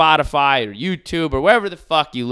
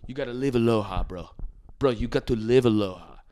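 imitating surfer guy; 4 dB quieter than usual. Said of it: "a cartoon of a Hawaiian person".

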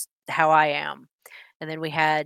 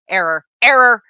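lightly voiced robot error; loud mock robot error alert; 6 semitones higher, 13 dB louder than usual. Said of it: "The look of 'data processing".

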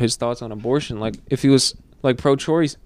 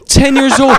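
much higher, louder exclamation; incredulous outburst; 10 semitones higher, 8 dB louder than usual. Real, not acted. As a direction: burst out in loud disbelief. Repeats kid's age, outraged disbelief.

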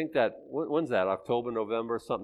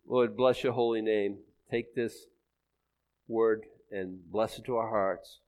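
prayer; 4 semitones lower than usual. Acted. Closing prayer.

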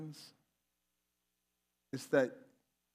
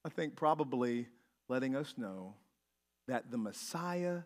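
normal talking voice

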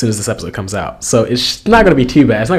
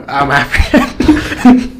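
slow laughing joke; 3 semitones higher, 3 dB louder, much slower than usual. Real, not acted. Joking about own ancestry.

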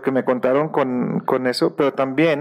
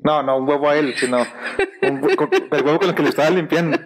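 normal talking voice

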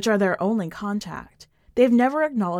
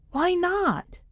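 much higher, defiant question; defiant challenge; 10 semitones higher than usual. Acted. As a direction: toss it back at him like a dare. Becky defiant about the bridge.

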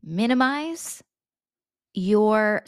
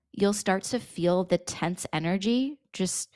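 ordinary talk

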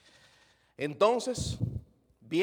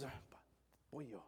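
much softer, lower shy reply; timid mumble; 4 semitones lower, 19 dB quieter than usual. Retold or imitated. Shy church member at the door answering the pastor, very soft. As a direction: shrink back and mumble.

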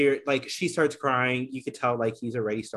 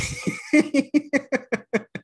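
a real burst of high laughter; shocked high laugh; 13 semitones higher, 5 dB louder than usual. Real, not acted. Reacting to a harsh line.

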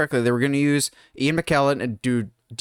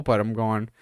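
ordinary delivery

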